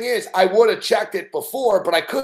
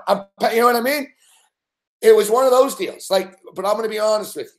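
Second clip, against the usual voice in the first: ordinary talk, nothing marked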